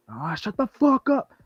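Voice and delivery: high, drawn-out mock yell; imitated yelling. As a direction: imitate a man yelling in the street, without full volume. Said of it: "that man was out there yelling healthily".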